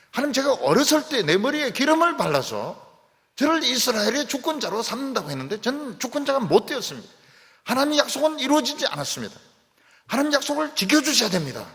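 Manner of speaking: high, loud, desperate plea to God; David's pleading prayer. Acted. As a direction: cry out as if holding God to his promise. (높고 크게 하나님께 매달리는 다윗의 간청)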